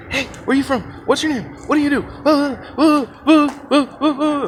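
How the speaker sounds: high-pitched pestering mock voice; mosquito-like pest. Eager guy pestering a girl at dinner, high mock mosquito voice. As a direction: buzz away like a mosquito, high and grating. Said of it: "buzzes in her ear like a mosquito".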